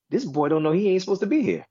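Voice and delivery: high-pitched, tickled disbelief; amazed reaction. Retold or imitated. Fan marveling at an underdog player, amazed.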